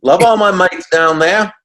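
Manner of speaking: loud, high, hammed-up Aussie accent; big cheerful Australian mate voice, cranked up. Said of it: "your best Aussie accent".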